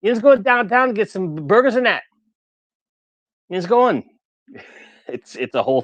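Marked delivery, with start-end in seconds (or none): 0.0-2.0 loud, high-pitched Pittsburgh yinzer; Pittsburgh accent. Acted. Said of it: "in Pittsburgh, we have Yinz". 3.5-4.0 high, loud yinzer call; Pittsburgh accent. Acted. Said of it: "in Pittsburgh, we have Yinz".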